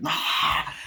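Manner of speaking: voiceless imitated roar; horror-film possession noise; mock demon cry. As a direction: rasp out an airy scream. Demon enters someone, retold.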